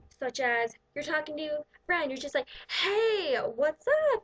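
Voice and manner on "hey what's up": chirpy greeting. Retold or imitated. Acting out a chat greeting.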